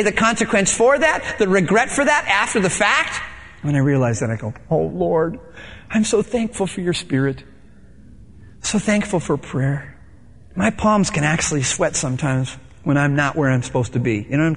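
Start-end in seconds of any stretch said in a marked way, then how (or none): 4.7-10.0 grateful prayer. Retold or imitated.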